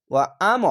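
"ওয়া আম" with a sudden push on আম; jolting emphasis. Shown as a demonstration. Teacher shows learners the sakin, pushed with a jolt. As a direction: jolt the আম and stop it short. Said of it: "ধাক্কা মেরে".

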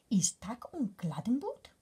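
breathy rising whisper; whispering bug question. Acted. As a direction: ask in a tiny bug whisper.

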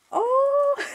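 sharp gasp into a high-pitched oh; delighted gasp. Real, not acted. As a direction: gasp in sheer delight at a gift. Opens a gift, delighted.

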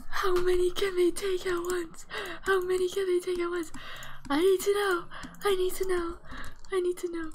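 high, loud, urgent pleading; frantic excited. Real, not acted. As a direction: demand to know, bursting with excitement. Desperate excited questions.

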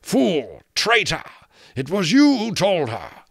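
high, loud, furious king; raging royal outburst; savage. A narrator's reading. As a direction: hurl the accusation savagely. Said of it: "rustling savagely under the uproar".

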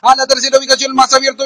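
ordinary delivery

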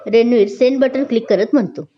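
plain reading voice

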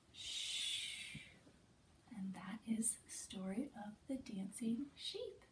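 soft whisper, slowing down; whispering over a crib. Shown as a demonstration. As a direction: hush the story to sleep. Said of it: "story ends in whisper".

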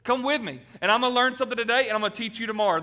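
loud, fast, high call to come along; eager invitation. Acted. Churchgoer inviting a neighbor in, loud and fast. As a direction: throw the door open to a stranger, full of energy. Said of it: "you're saying".

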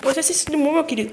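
ordinary delivery

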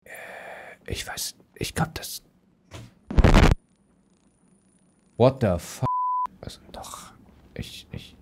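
hushed whisper, keeping silent. Real, not acted. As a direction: whisper every word.

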